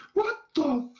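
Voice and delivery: high, loud, drawn-out scream of disbelief; horrified shriek. Retold or imitated. Worker with mop finds the bathroom mess, shrieking. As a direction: shriek it slowly, each word stretched, half laughing.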